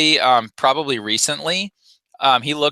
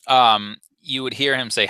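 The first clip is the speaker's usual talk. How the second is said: ordinary delivery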